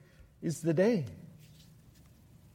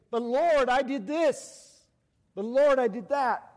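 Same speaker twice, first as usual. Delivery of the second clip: high, loud preacher voicing desperate claimants; raised-voice protest to the Lord; impassioned pleading; 10 semitones higher, 6 dB louder, slower than usual. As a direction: cry it out loud and high, stretching the opening words. Preacher voices pleading crowd to Jesus.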